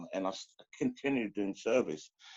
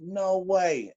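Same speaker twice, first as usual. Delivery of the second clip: stunned disbelief; 9 semitones higher, 5 dB louder than usual. Retold or imitated. Hearing brother was killed.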